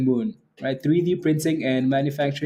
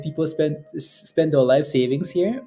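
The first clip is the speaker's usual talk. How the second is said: normal talking voice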